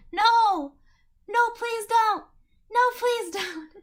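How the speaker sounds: high, loud begging no; pleading shout. Real, not acted. Streamer yelling at the game choice, loud pleading. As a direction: beg the screen to stop, half laughing.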